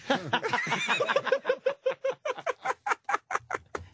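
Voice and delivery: squealing high laugh. Real, not acted. Big laugh after the dummy joke.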